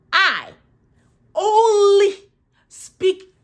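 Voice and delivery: high, loud, word by word; mock-outraged, high-pitched emphasis; indignant insistence. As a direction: punch out each word in protest. Indignant rebuttal about her English.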